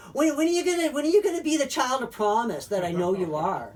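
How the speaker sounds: high, loud, nagging mother voice; mock mother's nag. Mother nagging her son about his future, high and loud. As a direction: whine it like a pushy mom. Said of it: "she wouldn't say it like that".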